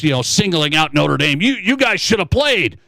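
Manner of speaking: normal talking voice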